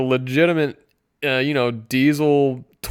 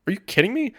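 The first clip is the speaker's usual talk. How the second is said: mock disbelief; 9 semitones higher, faster than usual. Reacting to the listing price.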